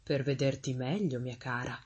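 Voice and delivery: falsely tender storybook wolf; low, sly reassurance to the child; wolf posing as granny. A narrator's reading. Wolf poses as grandmother.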